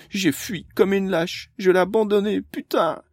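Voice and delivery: put-on a girl who ran away, ashamed, about to cry; near tears. A narrator's reading. Amélie blames herself, close to sobbing. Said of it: "sur le point d'éclater en sanglots".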